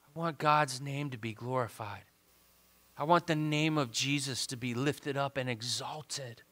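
soft, low, earnest declaration; subdued pulpit confession; hushed devotional preaching. Real, not acted. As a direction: say it quietly, as a personal prayer. Quiet heartfelt declaration.

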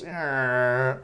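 drawn-out grating screech noise; nails-on-chalkboard screech. Retold or imitated. Teacher showing students the sound they hate, screeched imitation. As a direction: imitate fingernails scraping a blackboard.

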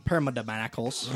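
Pterodactyls said as "pterma-da-mackles"; mispronounced word. Real, not acted. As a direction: Pterodactyls slurred into "perma-dam-ackles". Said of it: "Wait, what'd you just call them?".

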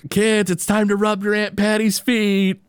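high-pitched mock call to the children; high imitation of calling the kids. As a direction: sing out a summons, imitating an aunt. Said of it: "we hear in the background".

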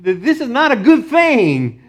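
high-pitched mock protest; Paul. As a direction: plead comically against bad news. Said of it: "you can imagine Paul going".

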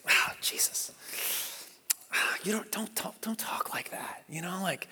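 acted whisper, hesitant and halting; hushed reproof. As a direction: take him aside and whisper your objection. Peter privately reproves Jesus.

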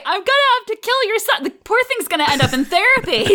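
fast, high-pitched fretting; mock worry. Expectant mother imagining her kid in therapy, mock worry. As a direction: rattle it off high in comic alarm. Imagined threat, worried retelling.

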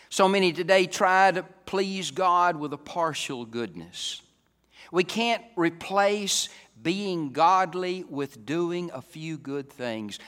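loud, high, deliberate pulpit voice; forceful preaching. Real, not acted. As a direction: press it on the congregation.

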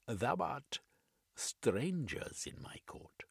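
a lonely king declaiming from his court; Sigurd's old-fashioned speech. A narrator's reading. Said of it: "His accent was curious, his mode of speaking old-fashioned".

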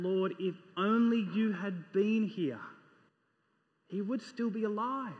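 questioning. Acted. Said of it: "You'd hear the, the question in her voice".

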